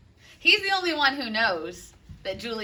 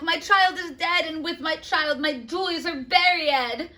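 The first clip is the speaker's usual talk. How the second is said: mounting rage, louder and higher toward the end; lightly voiced angry father; 6 semitones higher, 5 dB louder than usual. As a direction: build from bitter to raging grief. Capulet, angry at death.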